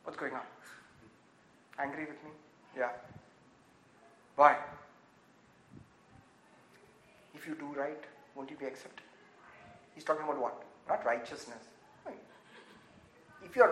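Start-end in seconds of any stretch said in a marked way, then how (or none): 0.0-9.0 hushed, patient questioning; softly ask, like a father to a sulking son; God asking Cain softly; gentle God voice. Acted. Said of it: "But God is like".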